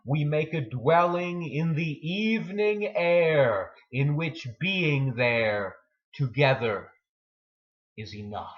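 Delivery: full-voiced recital of the closing lines; poem reading. Poem's closing lines, first take.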